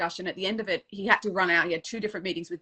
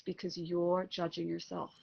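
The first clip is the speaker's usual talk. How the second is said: softer, slower, an inner voice answering; little inner voice; 9 dB quieter, slower than usual. Retold or imitated. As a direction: let the answer come quietly from inside. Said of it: "back came a little voice".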